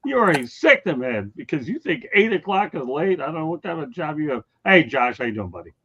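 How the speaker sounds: ordinary talk, nothing marked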